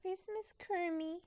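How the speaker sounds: lightly voiced Miss Piggy, high; a hint of Miss Piggy, high; Miss Piggy impression. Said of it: "That's how she sounds".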